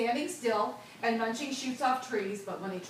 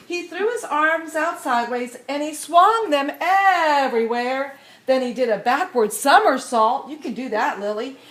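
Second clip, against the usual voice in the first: louder, higher, building excitement; excited reading; 4 semitones higher, 10 dB louder than usual. A narrator's reading. Reading the dance climax to children, building excitement. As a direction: read the big moment with growing thrill.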